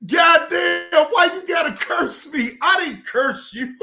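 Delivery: cracking up, voice shooting up high; laughing outburst. Real, not acted. Streamer reacts to being cursed out, laughing outburst. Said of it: "I was able to laugh".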